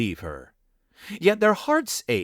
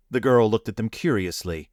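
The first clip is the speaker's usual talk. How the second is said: ordinary delivery, nothing marked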